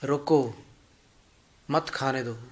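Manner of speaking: रोको alone, long pause, then मत खाने दो; pause after रोको. Shown as a demonstration. Someone warning that food is poisoned, long pause after रोको. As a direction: separate रोको clearly from मत खाने दो. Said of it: "पता चल जाता है किसी को".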